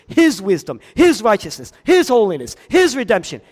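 loud, building preacher's cadence; preaching at full force. Acted. Builds sermon climax.

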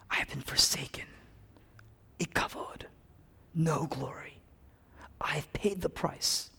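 soft, halting words of Christ; hushed quotation. Retold or imitated. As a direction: quote the dying Jesus quietly, with pauses. Quoting Christ on the cross.

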